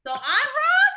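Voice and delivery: high, very loud disbelieving question; indignant shout. Real, not acted. Wife challenges being told she is wrong, indignant shout. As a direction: shout it back as an outraged challenge.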